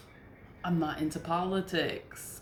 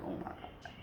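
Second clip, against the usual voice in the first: defeated whisper; 12 semitones lower, 14 dB quieter than usual. Retold or imitated. Exasperated at being ignored.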